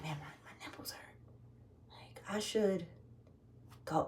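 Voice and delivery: secret whisper so he won't hear. Retold or imitated. Whispering to herself while he sits beside her. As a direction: breathe it out in a hushed whisper.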